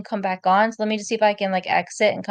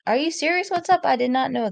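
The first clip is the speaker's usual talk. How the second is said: surprised; 7 semitones higher than usual. Real, not acted. Reacting to surprising news.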